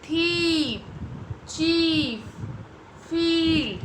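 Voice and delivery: thief, chief, field with a long, held "ee"; long E sound. Shown as a demonstration. Teacher reading the red-marked words, long "ee" in thief, chief, field. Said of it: "it's sound E".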